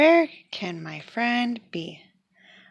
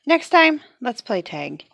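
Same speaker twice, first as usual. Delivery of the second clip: eager suggestion; 4 semitones higher, 5 dB louder than usual. Acted. Eager proposal.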